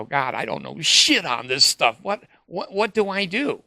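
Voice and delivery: high, exasperated plea; at a loss. Retold or imitated. Doubter asking his advisor what to do, at a loss. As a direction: climb into a helpless, high-pitched question.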